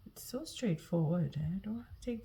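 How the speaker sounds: soft whisper to herself; murmured under the breath over the cards; whispered aside. Real, not acted. Quiet aside while laying cards.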